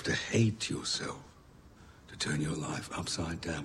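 ordinary delivery

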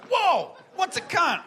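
put-on high-pitched, drawn-out child's amazed question; little nephew. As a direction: imitate a wide-eyed little boy blurting a question. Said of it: "My little nephew's like".

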